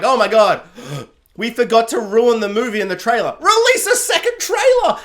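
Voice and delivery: loud, high, gasping mock alarm; gasp, then shout the order in a panic; hammed-up panicked executive; studio panic. Studio panicking in acted quote.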